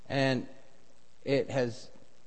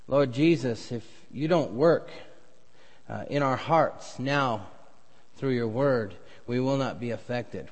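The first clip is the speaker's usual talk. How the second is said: earnest pulpit prayer, swelling louder mid-way; 4 dB louder than usual. Acted. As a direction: pray it over the congregation. Pastor leads opening prayer.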